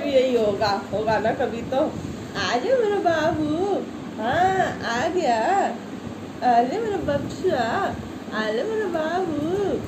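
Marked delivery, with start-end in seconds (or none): 1.8-9.8 ऊँची, बहुत धीमी दुलार भरी आवाज़; पालतू को लाड़ से पुकारो; प्यार से बुलाना. Real, not acted. कुत्ते ओरियो को प्यार से बुलाना. (ओरियो को धीमे, ऊँचे लाड़ से बुलाना)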